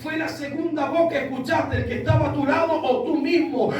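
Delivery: forceful sermon delivery, building to a shout; preaching. Acted. Preacher warns congregation about the voice of man, forceful preaching. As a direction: preach it out, stretching the key phrases.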